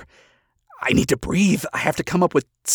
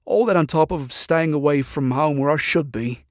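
ordinary delivery, nothing marked

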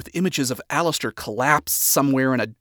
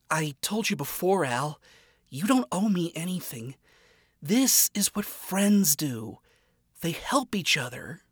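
gentle reassurance; 4 semitones higher, 4 dB quieter than usual. Acted. Charlie telling Alistair he owes nothing, soft and kind. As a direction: reassure a friend who feels indebted.

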